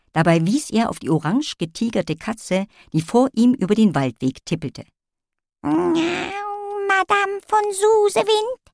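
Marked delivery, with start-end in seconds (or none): 5.6-8.6 high, dainty little cat lady; meowing cat voice. Acted. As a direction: meow it like a posh little cat. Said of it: "miaute die kleine Katzendame".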